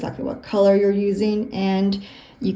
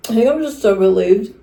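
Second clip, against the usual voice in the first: talking through a mouthful of cookie; words around a bite of food; mouth full; 2 semitones higher, 6 dB louder than usual. Real, not acted. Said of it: "stop talking with my mouth full".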